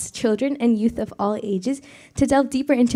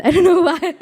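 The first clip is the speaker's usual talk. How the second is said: laughing through the words; 6 semitones higher, 5 dB louder than usual. Real, not acted. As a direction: giggle while speaking. Laughs at own breathlessness.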